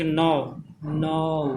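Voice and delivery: नाव drawn out, gliding from आ to उ; diphthong demonstration. Shown as a demonstration. Teacher showing two vowel sounds in नाव, drawn out. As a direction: stretch नाव so two vowels are heard. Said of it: "दो sound आ रहा है".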